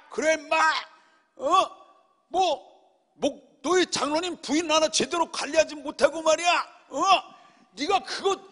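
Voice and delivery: mock furious tirade, pitched high; hammed-up angry yell. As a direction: pretend to tear into him in a rage. Imagined fight, yelling at pastor.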